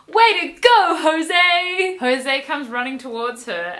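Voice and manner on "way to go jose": much higher and louder gushing cheer; mock excited cheer. Heroine cheering her friend's success, hammed-up high cheer. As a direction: squeal it like a thrilled fangirl.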